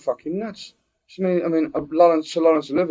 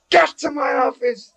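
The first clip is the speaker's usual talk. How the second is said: high, drawn-out schoolmaster's order; shouting teacher; 11 semitones higher than usual. Acted. Teacher catching boy with apple tree, shouting teacher. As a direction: yell it high like an outraged headmaster.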